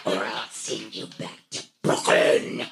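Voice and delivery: low growling Queens librarian; tiger librarian from Queens. Acted. Librarian shushing a patron, low growling Queens voice. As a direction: snarl it like a big-cat librarian from Queens. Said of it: "Tiger librarian from Queens".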